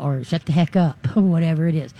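ordinary talk, nothing marked

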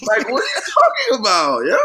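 very loud, high-pitched disbelief; incredulous shouted question; baffled outburst. Real, not acted. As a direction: yell it in sheer bafflement. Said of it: "I'm baffled by this".

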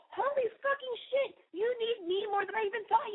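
high, excited exclamation; high squeal of mock shock; excited outburst. Real, not acted. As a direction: burst out in shocked delight. Opening outburst to listener.

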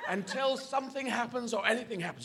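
ordinary delivery

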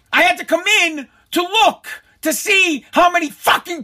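high, punched-out angry retort; worked-up retold outburst; exasperated rant. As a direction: hammer each phrase in indignation. Acted yelling match at post office.

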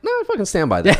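high, fast, defiant insistence. Retold or imitated. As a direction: dig in and defend it. Defending a joke he told.

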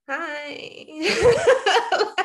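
stunned, happy greeting; laughing hi. Retold or imitated. Meeting her cousin by phone.

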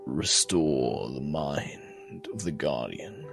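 low, slow god voice like dying embers; crackling ember voice. Acted. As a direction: speak slowly and low, like a fading fire. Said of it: "this crackling voice like embers of a dying fire".

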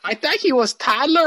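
high, hammed-up Mexican-sounding accent; mock Mexican-sounding accent. Host imitating partner's mom, high mock Mexican-sounding accent. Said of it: "she's not Mexican".

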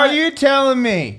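high-pitched mock disbelief; mock incredulity. Host challenging a friend's claim, mock incredulity. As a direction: act scandalized, voice shooting up.

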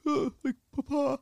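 high cracking plea, near tears; a son greeting his dying father through held-back tears; choked-up Papa. Retold or imitated. Son meets his dying dad on the porch, near tears.

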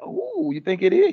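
hammed-up girlish surprise; high mock gossip. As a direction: gasp it like juicy gossip. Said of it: "She's like".

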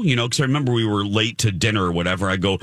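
normal talking voice

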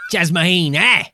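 mock Australian reporter, drawn out slowly; imitating a brash Australian interviewer; hammed-up Australian accent, slow. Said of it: "He's like".

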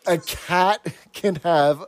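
drawn out, savoring each word; slowly repeating the odd saying back; slow mock recital. Friend repeating the saying slowly, teasing. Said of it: "I have never heard you say that".

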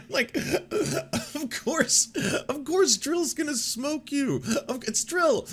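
high, slow disbelieving squeal half laughing; incredulous squeal. Real, not acted. To viewers about Drill smoking Elon, high slow incredulous squeal.

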